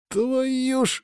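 high, held-out swear; cursing. A narrator's reading. Lyokha flung to the bottom with Sanya, cursing. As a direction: curse in a high strained voice. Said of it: "выругался Лёха".

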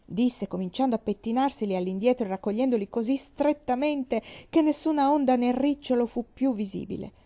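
usual speaking voice

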